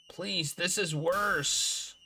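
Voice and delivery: slow plea. Acted.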